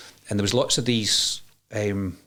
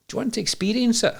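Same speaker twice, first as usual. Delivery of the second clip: high, gentle inviting voice; lightly voiced healer; 13 semitones higher than usual. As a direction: lift the voice like a coaxing healer. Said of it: "And he says".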